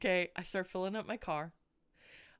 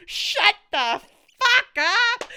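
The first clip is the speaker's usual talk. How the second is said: slow, high, loud mock-snarl; drawn-out drunken snap; 12 semitones higher, 11 dB louder, much slower than usual. Drunk Becky snapping at caring stranger in the taxi, slow and loud. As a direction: stretch it out like a sloppy drunk. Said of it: "And Becky's like".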